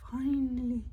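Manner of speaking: ordinary delivery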